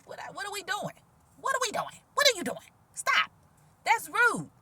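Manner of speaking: very high-pitched, playful telling-off; mock scolding. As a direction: squeal little protests in pretend outrage. Mock scolding of the rude callers.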